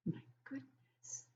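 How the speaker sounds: breathy whispered amazement; hushed wonder. Acted. Teacher marvelling at the page to the class in a whisper. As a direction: whisper it in awe at the picture.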